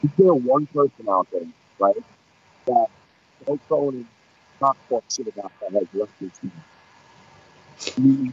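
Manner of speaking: talking into a covered mic; muffled mic. Real, not acted. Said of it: "Ken, you're covering your mic".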